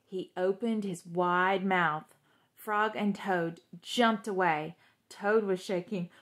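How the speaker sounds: ordinary delivery, nothing marked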